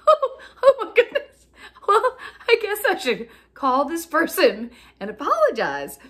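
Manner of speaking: high and loud, laughing through the words; real laughter breaking into the words; laughing in disbelief. Real, not acted. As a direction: giggle out the embarrassing realization. Realizing her own mistake.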